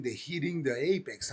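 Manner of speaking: ordinary talk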